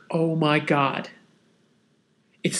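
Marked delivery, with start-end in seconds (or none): 0.1-1.1 low, soft retold dismay; hushed realization. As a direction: let it sink in quietly, under your breath. Retold dawning horror at friend's injury.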